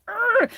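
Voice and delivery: high-pitched growl of frustration; mad growl, imitated. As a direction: re-enact an angry "arr", high up. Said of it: "I get so mad".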